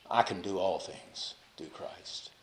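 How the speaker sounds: slow and soft, almost to oneself; soft inner prayer. Acted. Preacher voicing prisoners' inner thought, slow and soft. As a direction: murmur it slowly like a private prayer. Imagined thought of Paul and Silas.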